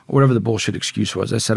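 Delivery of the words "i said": plain reading voice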